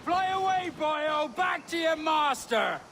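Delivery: high mocking yell after him; jeering shout. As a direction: chase him off with a jeer. Pirate jeering at fleeing sailor.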